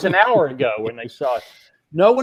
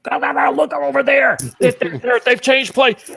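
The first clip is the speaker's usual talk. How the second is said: ordinary talk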